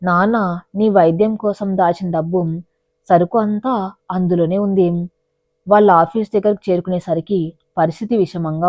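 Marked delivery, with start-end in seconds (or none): none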